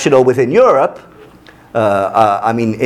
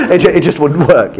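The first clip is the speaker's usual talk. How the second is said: laughing through the words; 3 semitones higher than usual. Real, not acted. Lecturer laughing at the French doorstep joke. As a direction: laugh it out, amused.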